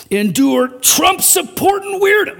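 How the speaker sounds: slow, high-pitched exasperated outburst; mock ranting. Preacher imitating a hearer fed up with opponents, mock high rant. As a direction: act out someone going off, stretching the words. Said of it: "and you start going off".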